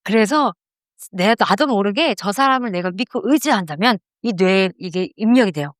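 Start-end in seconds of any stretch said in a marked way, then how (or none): none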